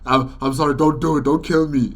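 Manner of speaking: frantic mock plea for mercy; mock pleading for life. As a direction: beg not to be killed, hammed-up. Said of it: "will start popping out and be like".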